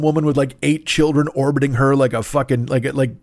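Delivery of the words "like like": ordinary talk, nothing marked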